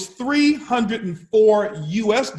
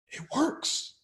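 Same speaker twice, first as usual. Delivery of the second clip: soft whisper, higher; 5 semitones higher, 11 dB quieter than usual. Shown as a demonstration. Speaker to audience, whispering the punchline again. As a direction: hush it like a secret.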